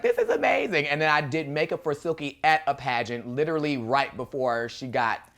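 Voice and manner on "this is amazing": thrilled. Retold or imitated. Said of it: "And I was like".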